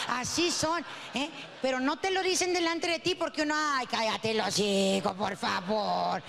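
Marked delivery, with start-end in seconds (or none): 3.6-6.2 lower, slow scoffing voice; mock scoffing. Comedian mocking a man's bragging, lower and slow. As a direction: drag it out like rolling your eyes.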